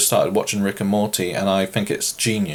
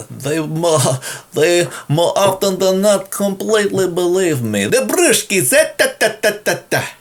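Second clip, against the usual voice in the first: high, louder over-the-top Russian accent; hammed-up Russian accent; 10 semitones higher, 4 dB louder than usual. Shown as a demonstration. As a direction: ham up a fake Russian accent and babble. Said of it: "I like to pretend that I'm from Russia".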